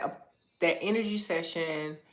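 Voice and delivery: usual speaking voice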